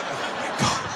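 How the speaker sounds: voiceless, aghast gasp of words; stunned whisper. Acted. Silent passengers watching a man on the bus, stunned whisper. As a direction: whisper it in shock. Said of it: "They were just looking like".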